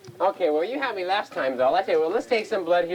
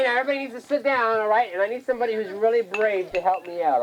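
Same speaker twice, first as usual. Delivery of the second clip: ordinary talk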